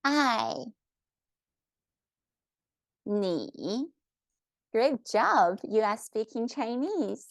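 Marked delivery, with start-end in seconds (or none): 0.0-0.7 "爱" high, then falling; careful fourth-tone "爱" for repeating; demonstrated Mandarin tone. Shown as a demonstration. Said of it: "say that one more time". 3.0-3.9 careful third-tone "你" for repeating; demonstrated Mandarin tone. Shown as a demonstration. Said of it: "say that one more time".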